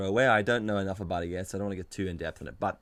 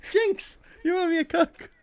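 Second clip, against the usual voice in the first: excited; 7 dB louder, slower than usual. Real, not acted. Jinx game shouted.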